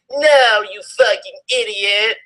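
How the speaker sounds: high hammed-up angry yell; mock shout. A narrator's reading. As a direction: snap it out in fake rage. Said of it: "I shouted".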